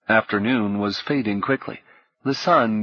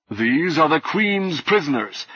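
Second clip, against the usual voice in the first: lightly voiced imperious knight, higher-pitched; a touch of stern authority; haughty Red Knight; 6 semitones higher than usual. A narrator's reading.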